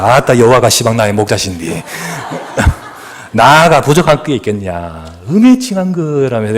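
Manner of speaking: 전라도 사투리 흉내, 느긋하게; playful Jeolla accent impression; Jeolla dialect. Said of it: "전라도 사람은 이렇게 말합니다". (전라도 사투리로 장난스럽게)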